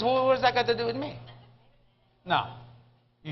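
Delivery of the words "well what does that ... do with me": Bill's retort. Retold or imitated. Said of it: "He says".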